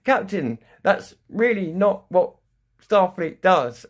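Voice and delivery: high, loud, slow pleading computer voice; mock ship computer imploring. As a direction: beg the captain like an alarmed computer. AI pleading with captain.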